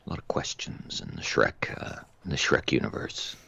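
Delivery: hushed, low conspiratorial whisper. Real, not acted. Musing to a friend about Shrek lore, whispering. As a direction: drop to a secret whisper. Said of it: "whispered aside".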